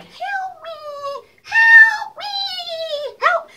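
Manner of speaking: squeaky high cry for help; tiny trapped fly crying out. Retold or imitated. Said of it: "the fly was talking, like".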